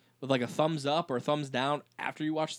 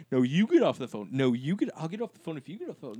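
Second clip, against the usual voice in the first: put-on teen phone bicker; 8 dB louder, faster than usual. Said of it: "it was like".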